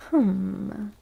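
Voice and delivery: ordinary delivery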